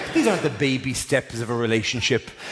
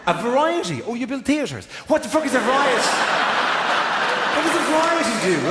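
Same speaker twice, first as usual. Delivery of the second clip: incredulous; 9 semitones higher than usual. Real, not acted. Comic mocking the vague answer.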